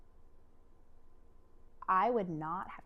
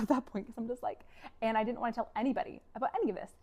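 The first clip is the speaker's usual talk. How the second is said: ordinary delivery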